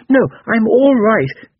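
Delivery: high, loud, slow protest; insistent upset boy. A narrator's reading. Boy refusing his mother's comfort, loudly insistent. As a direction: insist slowly in a high child's voice.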